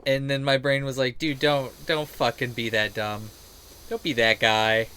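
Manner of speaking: ordinary talk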